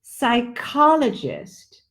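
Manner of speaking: "psychologist." spelled out slowly, syllable by syllable; teacher drawing "psychologist." out slowly; slow clear "psychologist."; slow demo. Shown as a demonstration. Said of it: "the next one is psychologist".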